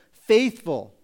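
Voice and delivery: a single emphatic "Faithful." that falls at the end. Shown as a demonstration. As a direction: land "Faithful." as one loud, weighty word. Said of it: "He makes an emphatic statement".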